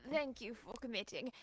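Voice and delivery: ordinary talk, nothing marked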